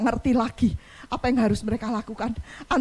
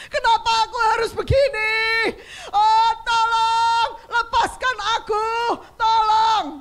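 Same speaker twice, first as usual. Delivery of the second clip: high, loud pleading wail; anguished cry; 8 semitones higher, 4 dB louder, slower than usual. Acted. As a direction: beg for release in agony. Voicing the sick who scream. (jeritan putus asa)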